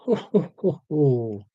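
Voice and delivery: a light, breathy mock ho ho ho; a quick playful Santa chuckle; Santa laugh. Talking to the stream, breaking into a light mock Santa laugh. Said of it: "I did the Santa laugh".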